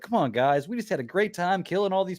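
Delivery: high-pitched whiny protest, imitated; mock mad. Imitating Vigilante scolding his fighting friends, mock mad. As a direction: complain up high, playing at being upset. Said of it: "he even gets mad".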